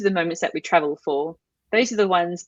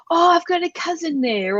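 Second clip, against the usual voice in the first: high-pitched, friendly imitation of eagerness; eager connection; 10 semitones higher than usual. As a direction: mimic a stranger delighted to find common ground. Imitates officers eagerly finding a connection.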